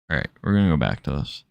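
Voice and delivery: talking through a nasal voice. Real, not acted. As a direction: say it nasally, quickly. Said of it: "Listen to my fucking nasally voice".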